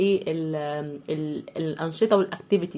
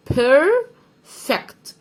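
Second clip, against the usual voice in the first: "بيرفكت" higher and louder, very slow, in two parts; split syllables; 6 semitones higher, 5 dB louder, much slower than usual. Shown as a demonstration. Teacher spelling out perfect for pupils, slow split "بيرفكت". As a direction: stretch "بير", pause, then "فكت".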